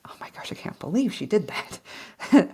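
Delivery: mock cringing whisper; embarrassed whisper. Looking back at her past self, embarrassed whisper. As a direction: whisper it as if ashamed of your past. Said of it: "I'd be really embarrassed".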